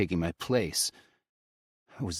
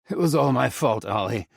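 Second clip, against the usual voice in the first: panting; 6 semitones higher, 5 dB louder than usual. A narrator's reading. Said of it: "I pant".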